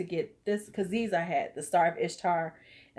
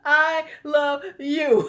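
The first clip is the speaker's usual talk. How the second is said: slow, loud and laughing, pitched up; laughing love you; 8 semitones higher, 9 dB louder, much slower than usual. Real, not acted. Laughing as she tells her viewer she loves her, slow and loud. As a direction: belt it out through laughter. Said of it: "laughing declaration to a viewer".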